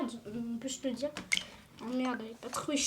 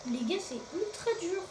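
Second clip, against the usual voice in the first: ordinary delivery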